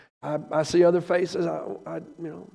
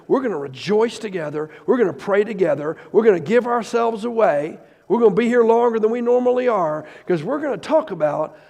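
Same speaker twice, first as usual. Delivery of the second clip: loud, high, impassioned preacher; fired-up sermon, ringing with emotion; full-force preaching; 4 semitones higher, 5 dB louder than usual. Acted. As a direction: preach it out to the whole room.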